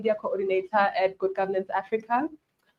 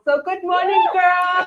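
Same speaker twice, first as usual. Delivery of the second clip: excited greeting; 9 semitones higher, 7 dB louder, slower than usual. Real, not acted. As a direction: call out good morning with a lift. Speaker greets the girls warmly.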